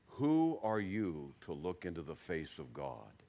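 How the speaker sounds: low, soft, slow and kindly. Retold or imitated. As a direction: speak gently as God, unhurried and hushed. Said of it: "in a kind voice".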